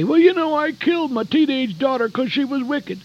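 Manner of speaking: lightly voiced crackpot; mock crackpot voice. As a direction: sneer it like a deluded fanatic. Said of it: "you hear these crackpots say".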